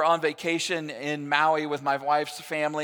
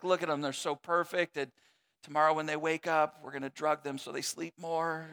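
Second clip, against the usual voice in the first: hushed bedside; 3 semitones lower, 7 dB quieter than usual. Retold or imitated. Parents watching sleeping kids.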